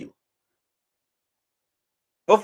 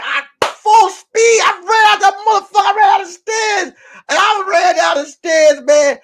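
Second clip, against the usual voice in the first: high, loud excited yelling; excited shouting; 9 semitones higher, 4 dB louder than usual. Real, not acted. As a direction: yell it out, hyped. Retelling the escape.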